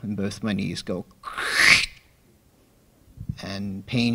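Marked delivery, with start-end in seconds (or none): 1.2-1.9 harsh noisy ripping sound; imitated Velcro rip. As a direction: mimic knees tearing like Velcro. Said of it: "what sounded like Velcro".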